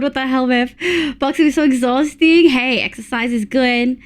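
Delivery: ordinary delivery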